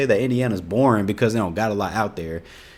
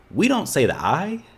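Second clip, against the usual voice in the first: indignant disbelief. Real, not acted. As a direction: pitch shooting up high in outrage. Outraged denial of fake slang.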